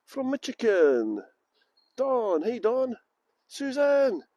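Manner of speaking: ordinary talk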